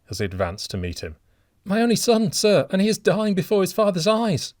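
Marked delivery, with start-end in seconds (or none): 1.6-4.5 high, loud, desperate cry for help; frantic plea. A narrator's reading. Old father begging a stranger to save his son, frantic high cry. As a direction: plead wildly for a drowning son. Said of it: "said the old man frantically".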